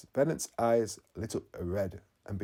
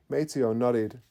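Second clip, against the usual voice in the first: usual speaking voice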